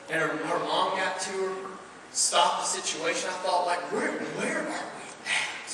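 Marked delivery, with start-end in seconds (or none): 3.9-5.7 slow, breathless whisper; whispered despair. Retold or imitated. Preacher recalling his thought about hurting kids, slow whisper. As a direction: whisper it like you've lost your way.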